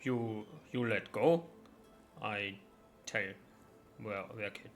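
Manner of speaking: dim, halting brute voice; dopey ogre voice. Acted. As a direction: speak like a slow-witted ogre.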